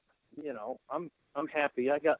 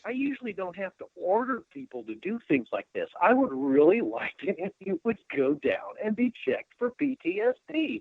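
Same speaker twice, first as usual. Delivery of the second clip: a stern three-star, pitched higher and lightly voiced; imitating the general; 7 semitones higher than usual. A three-star general pressing a reluctant colonel, lightly imitated and higher. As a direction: play the boss who won't take no, half-amused.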